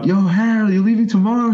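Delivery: calling across the street, pitched high. Retold or imitated. Spotting a bar friend in Pittsburgh, a high friendly call. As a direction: hail the bar buddy as he passes.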